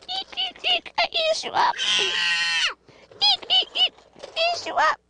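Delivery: high squeaky toy voice; be a playful squeaky toy monster; rubbery high squeaky toy. Pretend. Playing toy animals with child.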